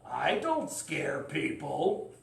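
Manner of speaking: slow, lightly voiced friendly dragon; gentle dragon voice. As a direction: drawl it like a harmless dragon. Voicing the dragon.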